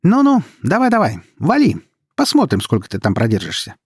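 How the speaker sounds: high, loud jeering start, easing down; mocking taunt. A narrator's reading. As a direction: egg them on derisively. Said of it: "насмешливо спросила Света".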